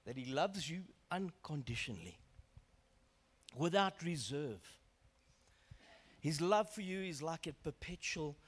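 soft, unhurried preaching with long pauses; hushed, reverent assurance; tender ministering. Real, not acted. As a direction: speak gently and intimately to the congregation. Softly ministers to congregation.

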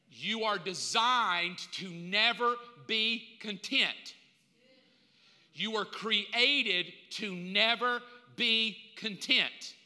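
slow, weighty delivery; preacher slowing to drive the point home; slow emphasis. Shown as a demonstration. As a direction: lay each word down slowly. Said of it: "slow emphatic sermon point".

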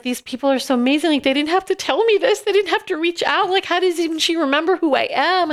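high-pitched, emotional retelling; overwhelmed, amazed gratitude. Retold or imitated. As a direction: relive being astonished they reached out, voice climbing high. Retells her tearful, amazed reaction.

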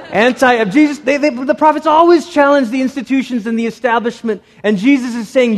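high, loud preaching push; fiery preaching. Acted. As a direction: drive it home like a preacher. Preaching at full force.

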